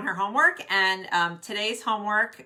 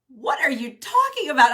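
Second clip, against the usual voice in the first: higher and louder mock disbelief; incredulous retort; 8 semitones higher, 9 dB louder than usual. Wife retelling her reply to her husband, loud incredulous disbelief. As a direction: throw it back at him, laughing him off. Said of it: "And I was like".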